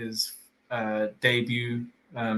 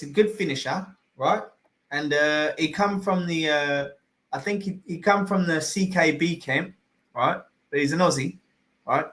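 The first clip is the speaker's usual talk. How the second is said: higher, hammed-up Australian voice; mock Aussie accent; 3 semitones higher than usual. Praising the Aussie fighter, higher mock Aussie accent. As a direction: keep the jokey Aussie accent going. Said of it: "we gotta do the accent".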